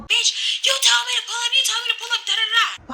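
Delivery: a high, mock scream from the angry girlfriend on the phone. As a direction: squeal it like a girl screaming down the phone. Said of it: "you can hear her screaming in the phone".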